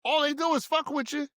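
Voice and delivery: real anger rising as he presses the point; worked-up outburst with the pitch climbing; getting mad. Real, not acted. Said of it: "You can see the veins in your head".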